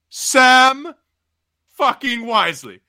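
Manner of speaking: high, emphatic disbelief, falling away; appalled Lucifer. Acted. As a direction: spit out the name in sheer disbelief.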